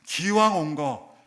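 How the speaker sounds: slow emphatic. Acted.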